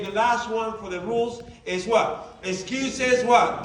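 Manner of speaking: normal talking voice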